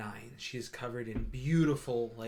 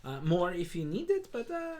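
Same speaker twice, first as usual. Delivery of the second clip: higher, bright-pitched welcoming shop cat; Moon the Tabaxi shopkeeper; 3 semitones higher than usual. Acted. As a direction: greet customers grandly in a high voice. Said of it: "she turns around and goes".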